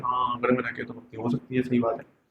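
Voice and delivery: hushed, giving-in thought; a wavering student quietly agreeing with himself. Retold or imitated. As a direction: murmur it as if talking yourself into it. Inner voice agreeing with parents.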